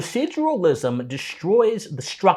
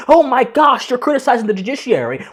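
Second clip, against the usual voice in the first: mock gasp of outrage, pitched higher; faux indignation; 6 semitones higher, 3 dB louder than usual. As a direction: act scandalized in a raised, hurried voice. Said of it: "and faux indignation saying".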